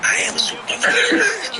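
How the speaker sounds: high, breathy self-insult; mock agreeing. Husband agreeing with angry wife, mock high. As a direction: squeak out the agreement. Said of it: "you gotta agree with it".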